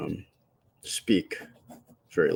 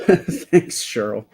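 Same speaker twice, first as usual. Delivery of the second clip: laughing thanks; 3 semitones higher, 7 dB louder, much slower than usual. Real, not acted. Reading a viewer's kind comment.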